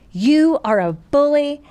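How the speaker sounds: drawn-out, emphatic mock yell; retold confrontation yell. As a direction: retell calling out a bully, word by word. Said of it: "I'm yelling all, and I'm crying".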